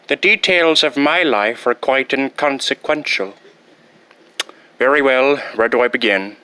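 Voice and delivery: ordinary talk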